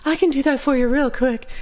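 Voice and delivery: high, giggly, sugary reply; hammed-up giggling sweetness; mock flirty clerk. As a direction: imitate the clerk fawning over a guy. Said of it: "she was super nice to him".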